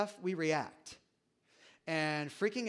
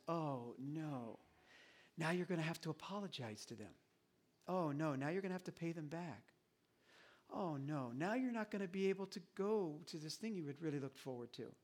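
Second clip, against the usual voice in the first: gentle sympathy; 2 semitones lower, 8 dB quieter than usual. Shown as a demonstration. Parent gently telling a child the consequences with sympathy. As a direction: grieve with the child as you say it. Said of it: "by saying two words".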